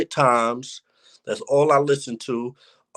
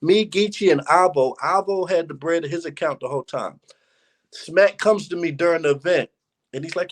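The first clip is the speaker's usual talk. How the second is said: ordinary talk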